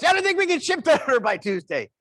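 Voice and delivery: loud, high cell-phone voice; loud phone talker. Retold or imitated. Phone talker sitting right next to him, talking really loud. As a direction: blare it out as if nobody's near. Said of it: "getting incrementally Doppler radar louder".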